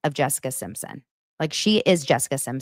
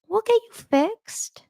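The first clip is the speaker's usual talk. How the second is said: wishing someone said it to her, cooing slowly; dreamy tender echo; slower than usual. Retold or imitated. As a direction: savour it like a lullaby. Say that to me when I'm sick.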